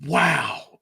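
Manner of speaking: high gasp then awed wow; awed listeners. Retold or imitated. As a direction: react as if moved by wisdom. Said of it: "And people are like".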